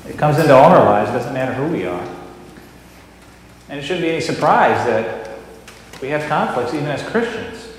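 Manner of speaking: loud, emphatic pulpit delivery with bursts of force; Sunday sermon, firm and loud; sermon preaching. Real, not acted. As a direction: preach it to the congregation, pressing each point. Pastor preaches after the skit.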